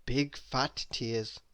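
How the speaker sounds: plain reading voice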